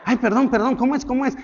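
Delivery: fumbling to apologise; flustered apology. Retold or imitated. Pastor caught in a slip, flustered apology. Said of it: "me miran y yo".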